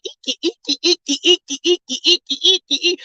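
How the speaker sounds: high, rhythmic eek-eek of a squeaky bed; squeaky bed imitation. Imitating the neighbors' bed for the listeners, high squeaks. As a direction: mimic the bedsprings squeaking upstairs. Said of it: "you got this squeaky deaky thing".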